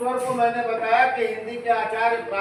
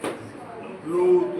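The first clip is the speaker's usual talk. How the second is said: drawn-out demo; 4 semitones lower, much slower than usual. Shown as a demonstration. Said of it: "यही क्या है".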